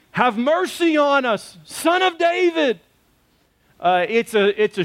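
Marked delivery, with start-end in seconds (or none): 0.1-2.8 high, slow pleading call; blind men crying out. Retold or imitated. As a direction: stretch it out like a beggar crying for mercy. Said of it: "crying out, krazoing out, yelling".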